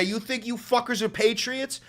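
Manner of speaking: normal talking voice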